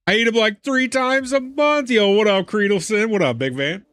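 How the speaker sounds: high, loud hype to a chatter; excited greeting. Real, not acted. Streamer greeting chatter Creedleson, high excited hype. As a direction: greet him like a buddy, fired up.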